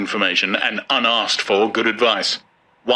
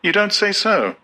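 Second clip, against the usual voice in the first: high, polite murmur of false interest; high mock-interested young woman; feigned interest; 11 semitones higher than usual. A narrator's reading. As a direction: fake being impressed, voice up high. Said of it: "simulated intelligence and said".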